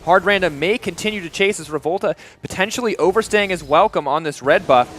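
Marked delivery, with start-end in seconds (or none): 0.0-2.1 loud, higher fast casting; live play-by-play. Real, not acted. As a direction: call the fight at full tilt. Live play-by-play at full pitch.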